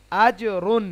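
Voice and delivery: আজ রুন, loud, "j" bounced before "run"; qalqalah bounce. Shown as a demonstration. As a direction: echo the "j" in আজ রুন. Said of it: "জিমটা কলকলা হবে".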